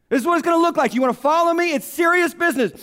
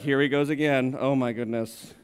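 lower, softer exasperated groan; weary eye-roll; 9 semitones lower, 5 dB quieter than usual. Retold or imitated. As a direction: sigh it out, here we go again. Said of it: "Philip's like".

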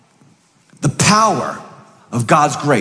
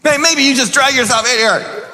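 preaching at full force; 7 semitones higher than usual. Acted. Preacher rallies the congregation, full-force shouting. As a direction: whip the church up to a peak.

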